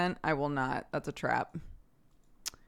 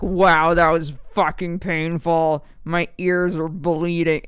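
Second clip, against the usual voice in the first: hammed-up appalled critic, much louder; loud mock outrage; 12 dB louder, slower than usual. Imitating a listener reacting to a bad accent, loud mock outrage. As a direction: blast it out as an exaggerated complaint.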